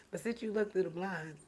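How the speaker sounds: normal talking voice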